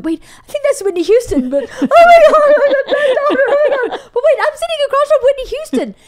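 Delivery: high, laughing, breathless disbelief; giddy shocked babbling; starstruck freakout. Retold or imitated. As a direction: squeal it like you can't believe who's there. Starstruck panic at spotting Whitney.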